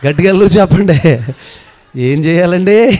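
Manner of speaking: loud urging call to the crowd. Real, not acted. Preacher urging the congregation to answer, loud call. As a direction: push the congregation to shout back.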